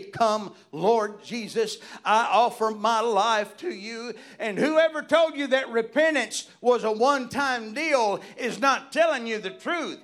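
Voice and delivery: high, loud preaching building to a shout; full-force preaching. Real, not acted. Preacher urging church folk to daily surrender, impassioned preaching. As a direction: cry it out to the congregation, climbing in pitch and power.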